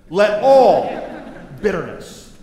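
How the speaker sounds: loud, slow, weighty; loud scripture reading. Retold or imitated. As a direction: lean into each word. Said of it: "Verse thirty-one, look at this".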